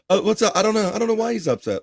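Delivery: lightly hammed-up Sean pretending not to know; imitating Sean. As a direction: play dumb, shrugging it off. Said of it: "and Sean says".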